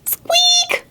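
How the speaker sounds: very high little mouse squeak; tiny squeak. A narrator's reading. Storyteller makes the tiny noise Owl hears, high squeak. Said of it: "he heard the noise".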